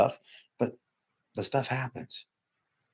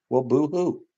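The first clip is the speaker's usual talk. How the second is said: higher, sarcastic "boo-hoo"; mocking boo-hoo; 7 semitones higher than usual. Real, not acted. Speaker mocking people who feel entitled to grief, sarcastic. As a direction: sneer at their self-pity. Repeats the mockery.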